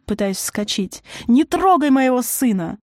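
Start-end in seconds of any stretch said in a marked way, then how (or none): 1.3-2.8 high, loud protective cry; raised, furious command; desperate yell. A narrator's reading. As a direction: cry out to guard your son. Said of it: "завопила она".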